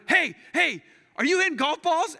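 high, loud accusing shout; imitated angry yelling. Angry man confronts the golfer, mock yelling.

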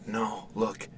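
whispered, barely there; father whispering to his wife. Acted. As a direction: point it out without breaking the spell. Whispering while son watches.

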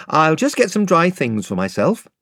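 lightly voiced friendly young king; Caspian. Caspian speaks to Lucy.

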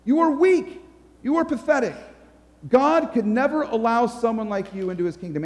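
mock-accusing, pitch jumping up on each jab; accusing devil. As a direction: pile on the blame as the devil. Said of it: "the devil comes along and he's like".

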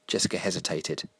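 ordinary delivery, nothing marked

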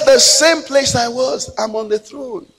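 high, loud, drawn-out reply; imitated answer ringing from on high; forceful acted answer. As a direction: proclaim God's answer slowly and loudly. Repeats God's answer forcefully.

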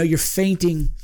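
ordinary talk

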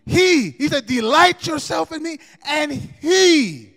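preaching at full force. Acted. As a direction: drive the promise home to the church. Preaching climax.

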